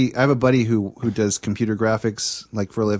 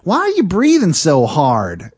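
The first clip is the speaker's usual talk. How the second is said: slow, louder, higher Texan drawl; Texan accent; 5 semitones higher, 5 dB louder, slower than usual. Retold or imitated. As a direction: imitate a Texan girl asking, slow and loud. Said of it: "that's what she sounded like".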